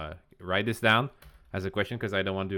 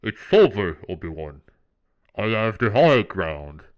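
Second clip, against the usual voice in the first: loud, slow, booming villain proclamation; hammed-up villain impression, bursting out loud; Darth Vader; 12 dB louder, slower than usual. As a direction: declare it like a menacing movie villain, drawn out. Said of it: "This is where Vader's gonna say".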